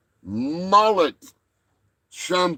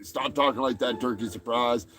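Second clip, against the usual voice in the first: usual speaking voice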